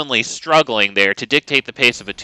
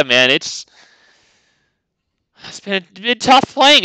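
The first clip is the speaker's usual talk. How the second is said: ordinary delivery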